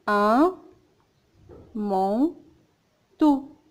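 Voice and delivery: "Un manteau" with long pauses between un, man and teau; syllable by syllable. Shown as a demonstration. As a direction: dictate "Un manteau" one isolated syllable at a time. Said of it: "Est-ce que j'entends en?". (« Un manteau » dicté syllabe par syllabe, avec de longues pauses)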